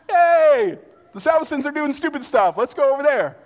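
put-on loud, high excited kid call. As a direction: yell to your friends across the yard. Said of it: "neighbor kids would come over".

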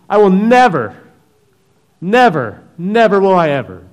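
shouted, high vow of never; a fervent shout; Peter's loud vow. Acted. Preacher voices Peter's loud vow.

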